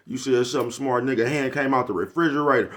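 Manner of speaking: ordinary talk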